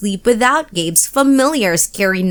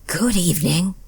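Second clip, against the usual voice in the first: low breathy monster; 3 semitones lower, 6 dB quieter than usual. A narrator's reading. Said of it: "said a low, breathy voice".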